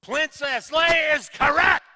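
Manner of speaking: loud, slow game-show call; big slow celebratory shout; excited announcement. Real, not acted. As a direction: proclaim the winning answer to the room. Host announces the right answer.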